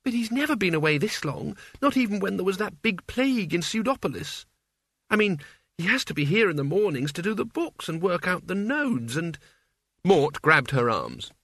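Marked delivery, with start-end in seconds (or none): 0.0-9.4 high, fretful character voice; Isabel, anxious young woman. Acted. Isabel frets that Father has been away, high and anxious. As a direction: worry aloud in a high-pitched voice.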